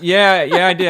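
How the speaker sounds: loud, high eager agreement; excited yeah. Real, not acted. Owning up to his coworker pranks, excited. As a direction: blurt it out with glee.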